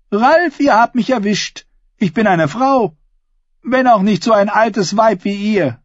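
high, projected mock confession; ringing, high-pitched comeback; bold woman taunting. A narrator's reading. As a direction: toss the insult back before the crowd.

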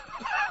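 a short snort of laughter; father snorts out a laugh. A narrator's reading. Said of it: "Ihr Vater schnaubte ein Lachen hervor". (kurzes schnaubendes Lachen)

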